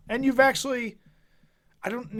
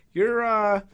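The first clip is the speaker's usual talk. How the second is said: ordinary talk, nothing marked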